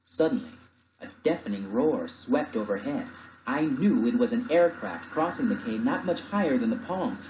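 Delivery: ordinary delivery, nothing marked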